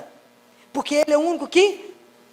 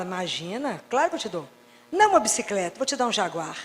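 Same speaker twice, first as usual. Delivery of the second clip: soft, lower, reassuring; softer, lower, tender promise; gentle divine answer; 6 semitones lower, 6 dB quieter than usual. Pretend. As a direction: answer like a kind father. God answering the prayer gently.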